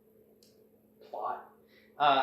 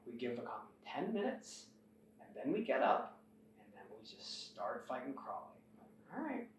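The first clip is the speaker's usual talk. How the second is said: hushed, softer whisper; whispered plotting; 3 semitones lower, 13 dB quieter than usual. Retold or imitated. Prisoners whispering an escape plan among the guards, hushed.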